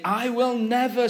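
high, emphatic acted refusal; impassioned promise; adamant vow. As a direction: protest with voice lifted high. Peter vows he won't deny.

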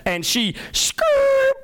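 very high, slow squealing tire screech; screeching brakes. Retold or imitated. Preacher mimics the bus braking hard, tire screech. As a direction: squeal it like bus tires skidding.

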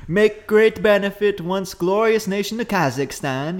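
put-on slow, deliberate Borat accent; a light Borat with broken English. Host quotes Borat, slow Borat accent. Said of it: "it's a fun accent".

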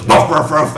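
barking like a dog; imitating a dog barking; mock dog bark. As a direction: woof it out like a playful dog. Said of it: "So he goes".

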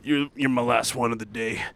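lightly voiced jaded rocker type; a hint of an offhand worker; imitated hairdresser. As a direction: imitate a barber who wants to leave. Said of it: "And then he goes".

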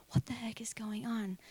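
half-whispered, incredulous question; soft whispered bewilderment; exasperated hushed disbelief. Retold or imitated. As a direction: hiss it under your breath in disbelief. Voicing her angry disbelief at God.

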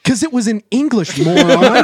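high, loud mock exasperation; loud retold put-down; exasperated comeback. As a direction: imitate someone fed up, half-laughing. Retelling her exasperated comeback.

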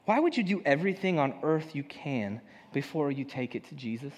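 ordinary talk

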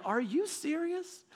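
high, drawn-out disbelief; retold stunned question; incredulous. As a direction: stretch it out in high-pitched astonishment. Retelling his shocked reply to dad.

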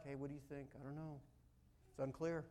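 low-key, quiet muttered confusion; soft, unsure voices in the dark; hushed uncertain exchange. Acted. People asking each other in the dark, hushed and unsure.

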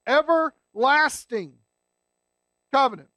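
"everlasting" stretched out slowly and firmly; slow emphatic. Shown as a demonstration. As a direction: drag out "everlasting" so they catch it. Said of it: "and pay attention, guys".

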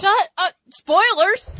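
outraged spoiler protest. Real, not acted. Friend yelling at a spoiler of Logan, a mock outraged shout. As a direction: stop him before he gives away the ending.

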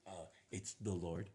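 soft and quiet; sheepish answer. Retold or imitated. Mary quietly telling Joseph who the father is, sheepish. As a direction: mumble it sheepishly.

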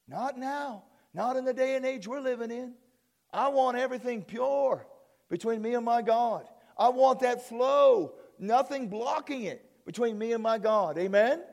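high, loud, fervent preacher voice; impassioned preaching. Real, not acted. Pastor declares what he wants with God, impassioned preaching. Preacher builds to passionate appeal.

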